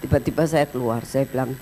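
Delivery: ordinary delivery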